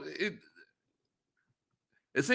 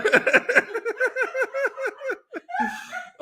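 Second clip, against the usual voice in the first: long high-pitched laughing fit. Real, not acted. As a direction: laugh helplessly. Cracks up at listener's comeback.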